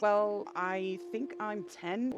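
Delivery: put-on slow unsure little kid; slow child voice. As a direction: drag out the age like a child.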